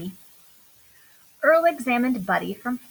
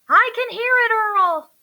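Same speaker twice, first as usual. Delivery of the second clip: much higher, louder call; crying out; 10 semitones higher, 6 dB louder than usual. A narrator's reading. Buddy answering Earl in the pretend storm, high and loud. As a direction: yell back over the storm. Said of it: "cried Buddy".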